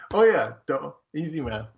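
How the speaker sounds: usual speaking voice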